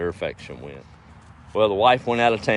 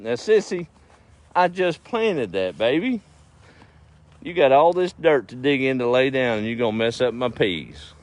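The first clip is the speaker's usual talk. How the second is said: scolding the dog; 6 semitones higher, 3 dB louder than usual. Real, not acted. Farmer to Sissy digging up his peas, scolding. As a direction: plead and scold in a higher voice.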